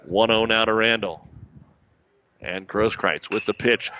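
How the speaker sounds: ordinary talk, nothing marked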